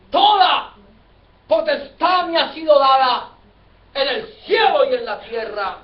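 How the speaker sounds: forceful pulpit quotation; preacher's proclamation. Acted. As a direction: declaim the scripture with full authority. Preacher proclaims the words of Jesus.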